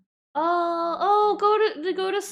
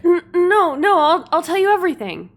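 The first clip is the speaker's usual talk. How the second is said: higher, louder stammered plea; hammed-up frightened prisoner; panicked pleading; 7 semitones higher, 4 dB louder than usual. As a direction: blurt out a surrender in mock panic. Plays the threatened prisoner pleading.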